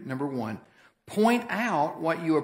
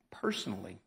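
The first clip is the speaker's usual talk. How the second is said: whisper; 7 semitones lower, 9 dB quieter than usual. Real, not acted.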